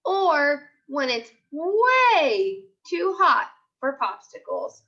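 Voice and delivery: put-on lively storybook voice for young kids; picture book read-aloud. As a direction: read it like a class story time. Teacher reads picture book aloud.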